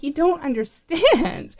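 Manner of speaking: high, drawn-out protest; exasperated retort. Retold or imitated. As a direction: plead to be understood, stretching the words. Retold protest to dismissive people.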